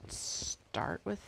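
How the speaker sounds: ordinary talk, nothing marked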